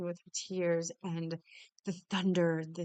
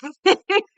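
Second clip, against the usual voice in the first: shrieking laugh, much higher and louder; 12 semitones higher, 14 dB louder than usual. Real, not acted. Runner laughing after falling in mud, high and loud. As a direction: burst out laughing with real joy.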